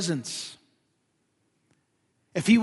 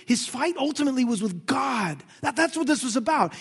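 normal talking voice

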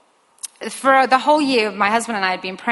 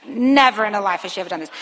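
usual speaking voice

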